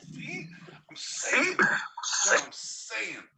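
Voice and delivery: high, loud, slowing on the last line; vindicated shout. Real, not acted. Telling co-host he was right, high and loud. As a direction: hammer home that you were right.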